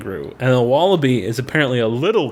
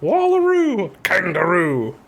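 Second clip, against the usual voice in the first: Pokemon evolution cry; 3 semitones higher, slower than usual. Pretend. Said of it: "sounds like a Pokemon evolution".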